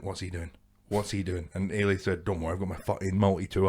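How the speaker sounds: low, very soft urgent aside; retold hushed exchange with a friend; hushed alarm. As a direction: keep it low and under the breath. Spooked, grabs friend on walk.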